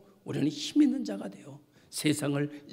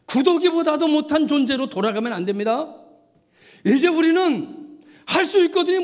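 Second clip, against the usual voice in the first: high, loud, driving proclamation; full-force preaching; 8 semitones higher, 6 dB louder than usual. Real, not acted. Preacher urging believers not to return to dry sticks, full-force preaching. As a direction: declare it boldly to the whole congregation.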